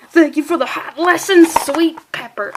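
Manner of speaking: high, loud gasping thanks; high loud overacted gratitude; loud character voice. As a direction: yell the thanks in ecstasy. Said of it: "he's getting a little loud".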